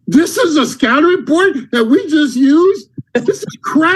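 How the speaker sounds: high-pitched mock outrage; retold angry rant. Boss looking over your scouting report, mock exasperated.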